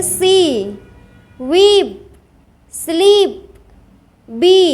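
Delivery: "सी, वीप, स्लीप, बी" with the ee sustained; long held ee. Shown as a demonstration. Teacher showing where ee is said for longer, "सी, वीप, स्लीप, बी" drawn out. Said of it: "जहाँ लंबा देर तक बोलेंगे".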